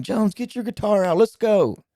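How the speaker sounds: high, loud imitation of an urging friend; rousing mock shout. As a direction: holler it to rally a buddy. Retells friend urging him to play.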